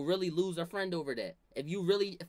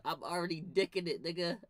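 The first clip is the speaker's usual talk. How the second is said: usual speaking voice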